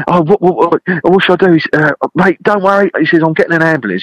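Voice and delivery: high, frantic mock panic; panicked friend. Friend panics while the angler fakes a seizure. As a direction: flap about, imitating sheer panic. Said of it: "Jim's gone crazy".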